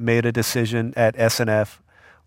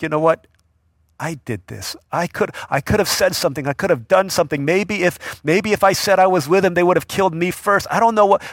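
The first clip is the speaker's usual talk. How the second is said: anguished self-blame; 7 semitones higher, 4 dB louder than usual. Retold or imitated. Peter voiced in anguish.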